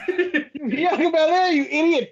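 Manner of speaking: hammed-up correction, laughing through it; laughing mock commentator yell; mock Michael Cole. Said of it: "He's like".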